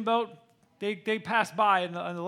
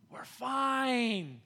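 drawn-out, softer brush-off; dismissive dad; 6 dB quieter than usual. Retold or imitated. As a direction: stretch out fine as if unbothered.

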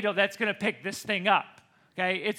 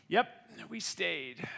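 low, soft, understated pride; self-satisfied dad; 4 semitones lower, 8 dB quieter, slower than usual. Retold or imitated. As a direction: imitate a dad quietly pleased with himself. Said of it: "He was proud of himself".

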